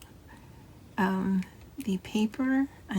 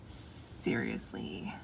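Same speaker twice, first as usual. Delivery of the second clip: "Seriously" drawn out slow, much lower and softer; slow low emphatic repeat; 7 dB quieter, much slower than usual. Shown as a demonstration. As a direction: drop the voice right down and stretch out "Seriously". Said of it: "I seriously love this sticker book".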